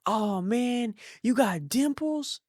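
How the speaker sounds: high, drawn-out delighted question; surprised dentist. Retold or imitated. Dentist spots the patient's dimples, high surprised delight.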